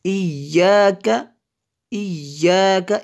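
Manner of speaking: इय्याका chanted with the Y leaned on; Tajweed इय्याका with a firm geminated Y; pressed double Y. Shown as a demonstration. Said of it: "इसको थोड़ा दबा के पढ़ेंगे".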